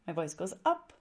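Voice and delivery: "up" glides upward like a question; rising ending. Shown as a demonstration. Coach showing how questions sound, rising on "up". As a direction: let the pitch climb on "up".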